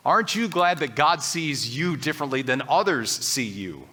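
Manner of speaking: normal talking voice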